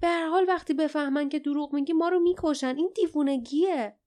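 heated, hopeless objection; angry despair. A narrator's reading. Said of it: "با خشم و ناامیدی".

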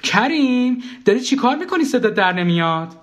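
high, acted shout to another room; high-pitched nagging call; calling out impatiently. A narrator's reading. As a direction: call out to someone not answering. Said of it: "دوباره داد زد".

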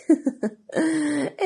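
stepmother's scornful chuckle; mocking laugh. A narrator's reading. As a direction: laugh at her hopeless request. Stepmother mocks Cinderella's wish.

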